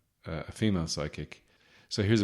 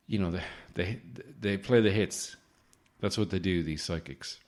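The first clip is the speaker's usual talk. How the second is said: ordinary talk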